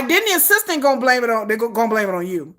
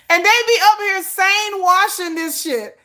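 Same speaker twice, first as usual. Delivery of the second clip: usual speaking voice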